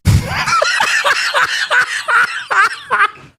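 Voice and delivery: high, long, loud laughter; loud laughing fit. Real, not acted. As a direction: burst out laughing at full volume. Reacting to insults.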